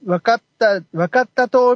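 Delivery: high-pitched mock Fukuoka dialect; imitated Kyushu dialect. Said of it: "九州弁っていうか、福岡弁？". (九州弁を高い声で真似て)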